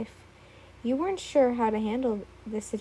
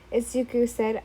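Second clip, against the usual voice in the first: ordinary delivery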